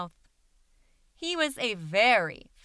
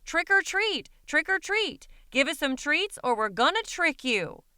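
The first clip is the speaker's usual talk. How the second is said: much higher, eager trick-or-treat calls; excited shouting; 6 semitones higher than usual. Acted. Monsters calling at houses for treats, high excited shouts. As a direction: call out like kids at every door. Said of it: "went around houses shouting".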